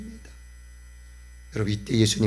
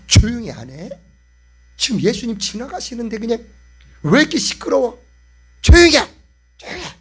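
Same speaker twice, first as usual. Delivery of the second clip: scolding; 8 semitones higher, 8 dB louder than usual. Acted. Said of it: "막 꾸짖어".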